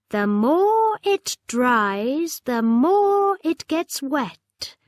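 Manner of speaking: slow, curious serpent voice. A narrator's reading. Serpent rising from the water, slowly asking. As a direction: draw the question out like a big sea creature.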